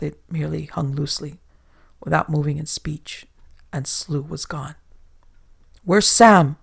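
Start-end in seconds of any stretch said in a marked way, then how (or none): 5.8-6.6 loud frantic shout. A narrator's reading. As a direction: yell it out across the boat. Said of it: "shouted Treach".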